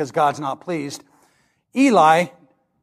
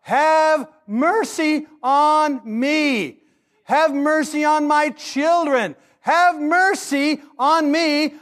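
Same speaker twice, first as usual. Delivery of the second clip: higher, slow, drawn-out plea to God; crying out for mercy; 8 semitones higher, much slower than usual. Pretend. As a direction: beg desperately, stretching each have mercy. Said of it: "I would scream out to God".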